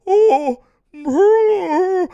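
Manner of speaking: high, loud, drawn-out mock wail; Paul Bearer's wail. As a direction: howl it like the creepy manager raising the urn. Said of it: "would raise his hand and go".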